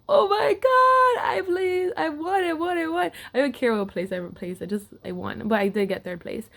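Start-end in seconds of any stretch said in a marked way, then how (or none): 0.1-3.1 high, loud, slow sobbing cry of joy, reliving the win; crying out in disbelief that she finally won; tearful joy. Retold or imitated. Retelling her winning moment to the camera, a high tearful cry.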